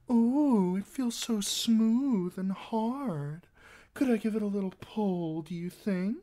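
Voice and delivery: high, slow mock-girlish voice; mimicking a girl, coy. A narrator's reading. Singer quoting a smitten maid, high coy imitation. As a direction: coo it high and drawn out.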